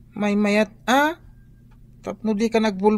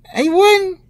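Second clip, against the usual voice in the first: loud protest; 8 semitones higher, 7 dB louder than usual. Acted. Child crying out to mother.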